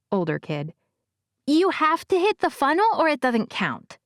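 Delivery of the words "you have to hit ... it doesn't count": put-on high young boy's voice with a lisp; higher childish voice with a lisp; little boy with a lisp. A narrator's reading. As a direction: voice a small lisping kid. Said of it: "the little boy said".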